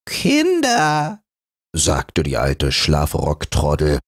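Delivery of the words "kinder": high, gentle calming call; an old granny calming quarrelling kids; old tassel soothing the scraps. Acted. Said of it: "begütigend".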